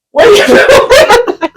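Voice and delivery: high, slow squeal of the angry friend; mock angry friend. Friend on the ride, mocked in a high shrill squeal. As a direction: ham up the friend's outraged question.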